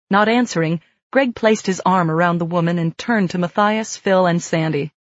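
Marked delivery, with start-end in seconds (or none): none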